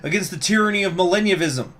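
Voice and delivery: put-on high, forceful accented speech; politician's impassioned, accented address; Bush voice. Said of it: "while doing a Bush voice".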